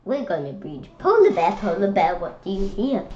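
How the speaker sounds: lively, sing-song picture-book read-aloud; reading to children. Acted. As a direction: read it like storytime for little kids.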